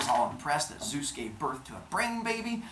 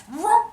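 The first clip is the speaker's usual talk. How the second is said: zooming whoosh; 10 dB louder than usual. Acted. Zeus's head closes up.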